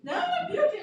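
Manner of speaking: high, laughing mock panic; freaking out. Wife panicking to husband after daughter walks in, mock panic. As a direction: squeal it out in a jokey panic. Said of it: "Yasmin is freaking out".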